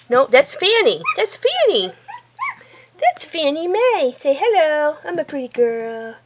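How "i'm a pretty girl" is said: lightly voiced pet, lower and softer; pet's voice. Owner speaking for her pet after "say hello", low and soft. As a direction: answer for the pet in a soft little voice.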